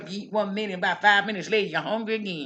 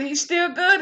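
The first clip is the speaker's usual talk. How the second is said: he's still good lifted high and louder, rising at the end; rising praise; 9 semitones higher, 4 dB louder than usual. Real, not acted. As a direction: lift it up like a shout of praise. Preaching refrain, praising God.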